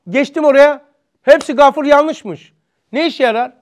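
loud, high, impassioned sermon voice; forceful preaching. Acted. Preacher pressing the congregation, loud and impassioned.

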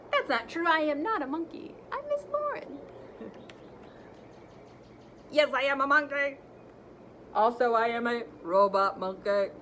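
lively, animated read-aloud; reading to children. Acted. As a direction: read it big for the kids. Teacher reads the book aloud expressively.

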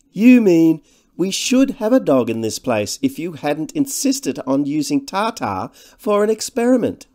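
high, loud, pointed retort; slender man. A narrator's reading. Slender man's sneering retort to companion.

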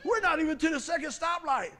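high, loud comic alarm; mock panic. Driver to himself in a failing truck, high shouted mock panic.

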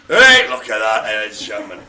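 showman call. Acted. Showman presenting the lemur again.

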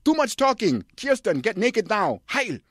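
very high, loud barking director; shouting director. Acted. Director shouting at his actress, very high and loud. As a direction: yell orders like a mad tyrant. Von Trier voiced shouting orders.

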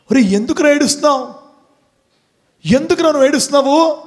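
high, quick retold demand; insistent questioning. Asking a crying boy why, insistent and high.

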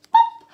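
high, loud popping sound; loud pop. Acted. Teacher lifting a flap for the children with a high loud pop. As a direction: pop it out sharply.

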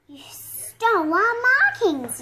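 loud, slow accusing yell. A narrator's reading. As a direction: yell it at the thieves. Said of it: "yell the other animals".

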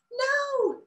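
a tiny high squeaked no; squeaky no. A narrator's reading. A bud refusing Bella, tiny high squeak. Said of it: "squeaked the bud".

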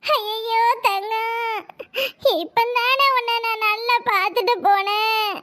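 slow, drawn-out crying over the body; dragged-out weeping lament; mock wailing lament. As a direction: wail it slowly like a hired mourner. Mourner wails over the dead girl.

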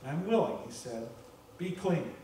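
soft, gentle reassurance; quiet tender assurance; compassionate. Pretend. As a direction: speak it tenderly, hushed. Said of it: "Filled with compassion".

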